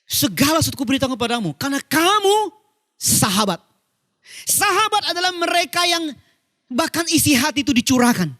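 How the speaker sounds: fervent, high preaching with pauses. Real, not acted. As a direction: pitch leaps on key words. Preaches with raised voice. (khotbah bersemangat, nada meninggi)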